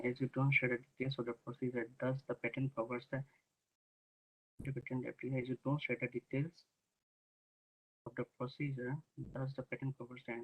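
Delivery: very low voice, hard to hear; reading the question quietly, far from the mic; barely audible. Real, not acted. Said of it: "your voice is very low".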